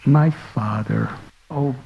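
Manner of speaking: tender child's reply, gently voiced; gentle and sweet. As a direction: gently answer the angry father. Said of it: "very gently and sweetly replying".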